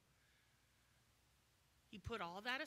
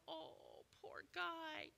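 high, soft pitying coo; mock pity; 6 semitones higher, 4 dB quieter, much slower than usual. Preacher mocking soft sympathy, higher pitch. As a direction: sigh it out with fake pity. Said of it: "No, he didn't go".

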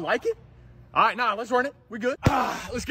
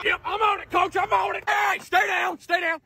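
high, urgent shout; urgent trainer; 8 semitones higher, slower than usual. Acted. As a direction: call out as you rush to an injured player. Trainer rushes to injured player.

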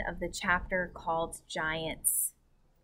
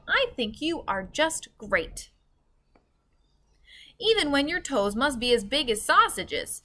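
put-on kind girl; 7 semitones higher, 3 dB louder than usual. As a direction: cheer up the giant gently. Sophie comforting the giant.

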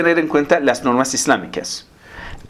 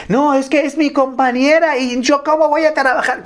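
much higher mocking whine; whiny excuse; 12 semitones higher than usual. Man excusing staring at his coworker, high whiny parody. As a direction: parody a man's lame excuse.

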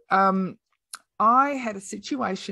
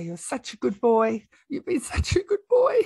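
choked up, tender words to a dying dog; real tears breaking through; crying; 6 semitones higher, 4 dB louder than usual. Real, not acted. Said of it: "I never planned to cry on your podcast".

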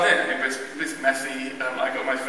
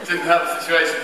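normal talking voice

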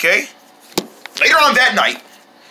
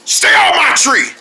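shouting; 7 semitones higher, 3 dB louder than usual. Acted. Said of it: "I said".

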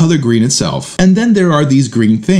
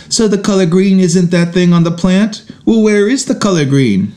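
put-on higher, puzzled kid voice; a child poking holes in the explanation; smart-aleck kid; 6 semitones higher than usual. Dad imitating his son's puzzled follow-up in a higher voice. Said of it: "He responds".

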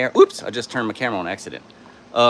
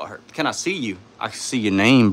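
ordinary talk, nothing marked

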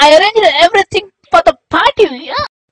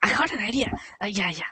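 fast secretive whisper; 6 semitones lower, 14 dB quieter, faster than usual. Acted. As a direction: whisper the plan so no one hears. Wife plots quietly.

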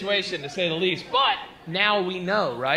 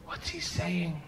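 soft, hushed question; discreet asking; 7 dB quieter, slower than usual. Acted. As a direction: ask him quietly, on the side. Furtively asking another man.

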